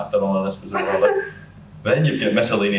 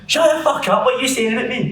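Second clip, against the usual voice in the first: much higher, louder mock screech; furious yell; 5 dB louder than usual. Girlfriend yelling at the man talking about her, much higher and louder. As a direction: scream it at him like she's ready to fight.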